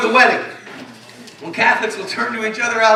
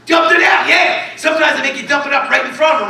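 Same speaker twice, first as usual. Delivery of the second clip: mock outrage; 6 semitones higher, 3 dB louder than usual. Retelling cops making you dump beer.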